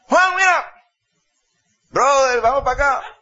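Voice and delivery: loud, high buddy call; loud high put-on hanger-on voice; fawning friend calling. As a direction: call him over like a freeloader. Friends call the prodigal over.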